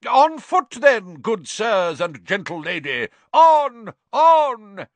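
high, loud rallying call, slow at first; crying out. A narrator's reading. Knight without a mount urges companions onward, crying out. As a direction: gallant knight bellowing a charge, drawn-out. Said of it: "and cried".

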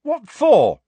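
loud, high outburst; angry. A narrator's reading. Pupil protests the lost points, angry. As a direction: burst out indignantly. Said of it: "said Harry angrily".

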